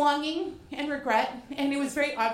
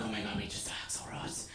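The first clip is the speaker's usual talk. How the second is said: stunned whisper; 5 semitones lower, 11 dB quieter, faster than usual. Retold or imitated.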